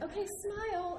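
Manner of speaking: high, sweet sing-song; sing-song photographer. Retold or imitated. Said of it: "was like".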